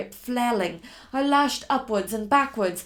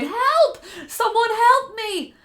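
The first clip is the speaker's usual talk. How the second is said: loud, much higher desperate cry; crying for help; 12 semitones higher, 10 dB louder, slower than usual. Acted. Fleeing woman calling for help, loud and high. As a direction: scream for help into the dark. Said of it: "I cried".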